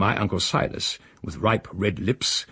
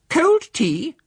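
much higher, louder scornful snort of a question; snorting lady; 6 dB louder than usual. Acted. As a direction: scoff it out after a snort. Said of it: "Giving a little sort of snort".

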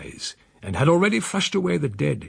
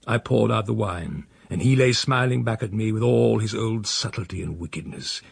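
ordinary delivery

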